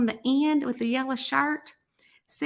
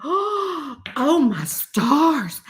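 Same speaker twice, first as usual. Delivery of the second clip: hugely drawn-out gushing wonder; hammed-up amazement; 3 semitones higher, 4 dB louder, much slower than usual. Re-enacting her reaction to the kitten, amazed. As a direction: stretch it out like a swoon of delight.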